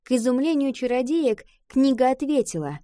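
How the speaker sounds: ordinary delivery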